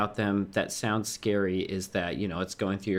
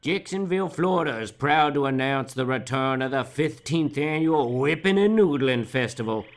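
loud, high, hammed-up festival ad read; radio ad announcer; 6 semitones higher, 5 dB louder than usual. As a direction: sell it like a cheesy local radio spot. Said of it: "you're gonna hear a ad tailored just for you".

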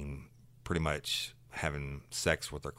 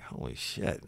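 breathed out, low and soft; a hushed, astonished reaction; stunned whisper; 3 semitones lower, 5 dB quieter than usual. Retold or imitated. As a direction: whisper it in disbelief. Teller's stunned reaction to the story.

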